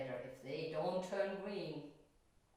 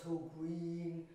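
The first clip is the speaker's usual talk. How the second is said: words stretched very slow to show dullness; slow and boring said slowly; drawn-out slow; much slower than usual. Shown as a demonstration. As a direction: drag it out like something boring. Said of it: "so green and slow and boring".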